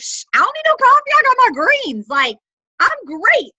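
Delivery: high, loud, mock-boastful brush-off; playful brag. Seller retelling her answer to curious friends, a playfully boastful brag. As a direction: wave off the coffee question with glee. Bragging about greens to friends.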